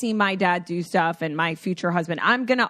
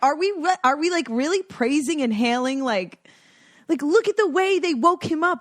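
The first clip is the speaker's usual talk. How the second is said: high, mocking disbelief; incredulous; 6 semitones higher than usual. Mocking friends who praise dads, high incredulous. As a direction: scoff at the fuss, pitched up. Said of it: "I'm like".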